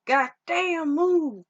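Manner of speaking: drawn-out, high-pitched urgent exclamation; retold urgent cry. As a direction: imitate someone yelling to get out of the way. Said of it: "looks up and was like".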